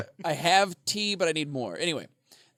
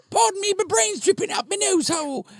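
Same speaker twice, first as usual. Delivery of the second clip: very high, loud mock-Cockney; Monty Python Cockney; 5 dB louder than usual. Rotting head begging pardon, high squawky Cockney. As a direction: squeal it as a silly Cockney head. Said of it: "Monty Python Cockney accent".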